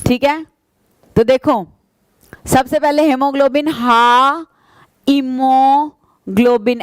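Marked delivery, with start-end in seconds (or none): 5.1-6.8 इमोग्लोबिन broken into slow separate syllables. Shown as a demonstration. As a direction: say इमोग्लोबिन slowly, piece by piece. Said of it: "ऐसे तोड़ तोड़ के याद करोगे". (इमोग्लोबिन को तोड़ तोड़ के धीरे बोलना)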